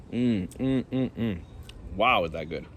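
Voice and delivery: talking through a mouthful of fruit; chewing noisily. Real, not acted. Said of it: "excuse me eating while I'm talking here".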